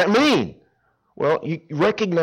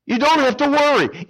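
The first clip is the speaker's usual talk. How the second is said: much higher and louder, ringing out; emphatic reassurance; 13 semitones higher, 4 dB louder than usual. Acted. Preacher loudly reassures the congregation not to worry. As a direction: lift it up to lift them up. Preacher stresses repeated line.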